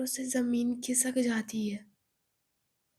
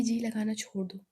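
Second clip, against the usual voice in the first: plain reading voice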